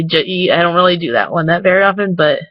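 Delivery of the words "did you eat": "did you eat" squeezed to juh-EET; run-together slang. Shown as a demonstration. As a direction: drop the start of did you eat, leaving jeet. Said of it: "I don't really say".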